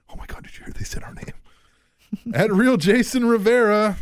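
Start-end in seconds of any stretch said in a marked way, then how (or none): 0.1-1.3 fast whisper. Retold or imitated. Gossiping TNA imitated.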